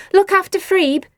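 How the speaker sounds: high, loud shout over the shoulder; Nelly shouting as she runs. A narrator's reading. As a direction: shout it while running. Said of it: "she shouted".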